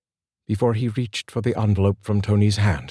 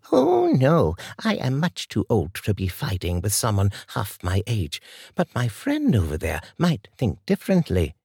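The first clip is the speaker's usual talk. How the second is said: put-on composed older woman Martha; 3 semitones higher than usual. A narrator's reading. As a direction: calmly warn the gunman as an old lady. Martha voiced in the story.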